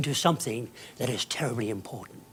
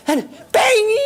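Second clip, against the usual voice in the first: bang shout; 9 dB louder than usual. Acted. Comic disaster, shout.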